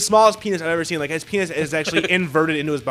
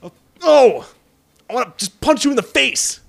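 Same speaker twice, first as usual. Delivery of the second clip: mock angry; 8 semitones higher, 6 dB louder than usual. Real, not acted. Playful fury at co-host.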